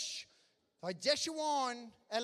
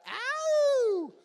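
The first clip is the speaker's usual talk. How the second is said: very high, loud whooping ow; 12 dB louder than usual. Real, not acted. MC hyping the crowd before asking for a clap, high whoop.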